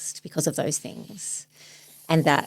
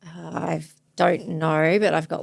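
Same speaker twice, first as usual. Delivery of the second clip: ordinary talk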